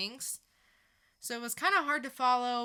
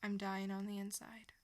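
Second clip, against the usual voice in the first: soft unvoiced whisper of defeat; jokey whispered confession of defeat; whispered despair; 8 dB quieter than usual. Real, not acted. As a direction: whisper it, half-joking anguish. Admitting she guessed wrong.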